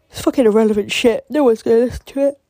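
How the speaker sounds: words stretched through a real yawn; talking mid-yawn, high and loud. Real, not acted. Tired podcaster yawning while ranting about her podcast. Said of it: "I need to stop yawning".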